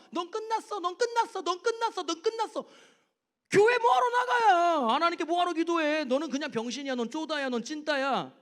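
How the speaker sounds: 점점 높고 크게 몰아붙이는 마귀의 외침; 설교단에서 마귀를 흉내 내며 고함치기; 소리치는 마귀. Retold or imitated. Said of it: "마귀가요, 공격하고 유혹하는데". (높고 크게 몰아치는 마귀의 고함)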